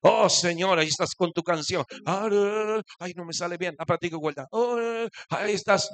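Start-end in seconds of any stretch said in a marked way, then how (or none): none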